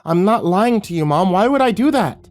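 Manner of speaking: high, loud desperate pleading, voice climbing; a frightened child begging to be believed; sputtering boy. A narrator's reading. Boy pleads with his mom, high and desperate. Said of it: "He sputtered".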